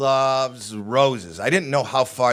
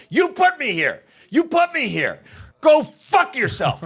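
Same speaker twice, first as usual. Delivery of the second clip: demented mom's furious yell; 11 semitones higher than usual. Retold or imitated. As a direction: bark it at your son like an angry old mom. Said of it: "she's never talked like that".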